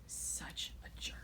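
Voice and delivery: breathy whisper under her breath; whispered aside. A narrator's reading. Girl whispers an insult at rival Chris. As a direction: hiss it so nobody hears.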